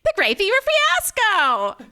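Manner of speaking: high, grating, annoying voice; be the annoying passenger on the train; annoying person. Pretend. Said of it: "like the annoying person".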